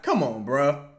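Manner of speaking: ordinary delivery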